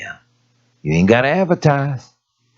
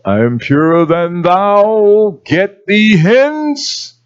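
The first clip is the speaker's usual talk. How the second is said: slow, lofty, high mock piety; haughty holier-than-thou; 6 semitones higher, 3 dB louder, much slower than usual. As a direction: drag it out like a smug saint. Mocking a self-righteous believer.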